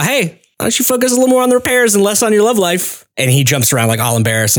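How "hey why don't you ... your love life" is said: high, teasing reprimand; teasing officer. Retold or imitated. Officer teasing the boy behind him, higher. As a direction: needle him about his love life. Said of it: "she's like".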